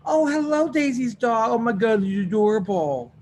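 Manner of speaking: high, loud gushing at a dog; talking to a dog. Real, not acted. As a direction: coo over a cute puppy. Teacher greets a dog.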